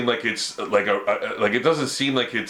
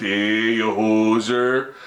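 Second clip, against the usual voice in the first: slow, exaggerated Canadian hoser accent; mock Canadian accent; 3 semitones lower, much slower than usual. Shown as a demonstration. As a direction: do an American's cartoon version of a Canadian, drawn out. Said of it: "when Americans do Canadian accent".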